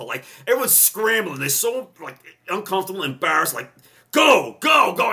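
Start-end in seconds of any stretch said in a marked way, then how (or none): 4.1-5.1 high, loud shout of go go go; high, loud shouting to hurry the men along; retold shouted order. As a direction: yell at the recruits to move, pitch way up. Medic hurrying recruits out.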